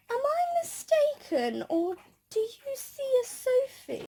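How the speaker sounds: high, slow, mock-theatrical question; hammed-up panto dame. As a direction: ham it up like a panto dame spotting a victim. Ugly Sisters voiced teasing her.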